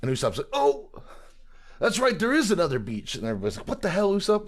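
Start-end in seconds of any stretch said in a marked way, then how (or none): 0.5-3.2 high-pitched "just remembered" outburst; mock sudden realization. Usopp's sudden realization, retold. 3.7-4.5 high-pitched collective outrage, imitated; mock exasperation. Everybody exasperated at Usopp, retold.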